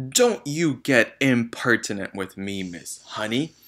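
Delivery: loud, cutting snap; snapped. A narrator's reading. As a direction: slap the teacher down. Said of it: "the Trunchbull snapped".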